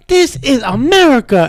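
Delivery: high, hammed-up declaration; mock patriot. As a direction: proclaim it like a cartoon patriot. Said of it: "I'm like".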